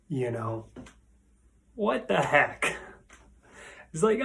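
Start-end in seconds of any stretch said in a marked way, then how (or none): 1.7-2.7 higher, falling exasperation; frustrated. Shown as a demonstration. Teacher giving a similar phrase, frustrated. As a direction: toss it out, fed up. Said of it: "you're frustrated".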